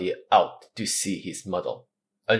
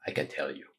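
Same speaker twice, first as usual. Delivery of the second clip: whispered under the pain; 5 semitones lower, 11 dB quieter, faster than usual. Acted. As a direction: breathe it out, hurting. Wounded Athos, in pain.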